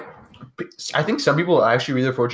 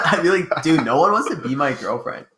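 laughing; 4 semitones higher than usual. Retold or imitated. Laughing retelling his twelve-year-old self.

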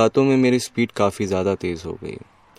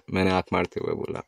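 ordinary delivery

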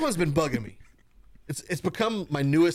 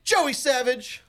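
ringmaster; 8 semitones higher, 3 dB louder, much slower than usual. Acted. Announcing tag team names.

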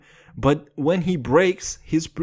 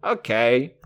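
ordinary talk

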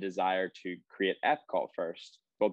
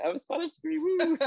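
high, squealing show-off voice; mock boastful; 4 dB louder than usual. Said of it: "trying to get out my Spanish degree".

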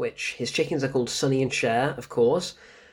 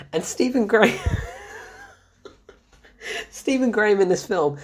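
words breaking into laughter; can't get through it for laughing; 5 semitones higher than usual. Real, not acted. Cracking up telling the scene.

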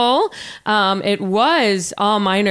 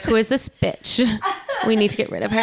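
imitating AOC; 3 dB quieter than usual. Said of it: "A O C would be like".

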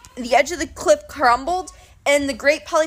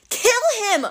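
high, fierce yell; 11 semitones higher than usual. Acted. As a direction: urge the kill. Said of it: "Clarisse yelled".

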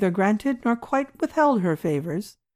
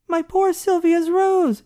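high, loud, slow lament; dismayed exclamation; 11 semitones higher, 5 dB louder, slower than usual. A narrator's reading. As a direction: cry out in pity for the rose. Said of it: "ejaculated Doctor Heidegger".

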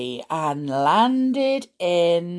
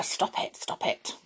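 softer, whispered stop it to herself; whispered self-scold; 8 dB quieter than usual. Real, not acted. To herself, reining in the cuteness, whispered. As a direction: hush yourself, half laughing. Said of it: "telling herself to stop".

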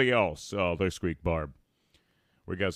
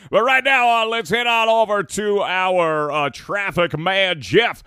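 radio host; 9 semitones higher, 6 dB louder than usual. Acted. Live show anchor toss.

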